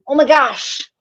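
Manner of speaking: ordinary talk, nothing marked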